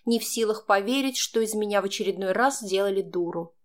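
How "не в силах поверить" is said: usual speaking voice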